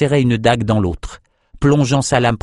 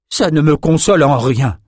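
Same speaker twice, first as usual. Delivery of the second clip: higher, wounded retort; hurt reply, pitched up; bitter rejection; 5 semitones higher, 3 dB louder than usual. Acted. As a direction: push the comfort away. Mourner rejects Olgia's consolation.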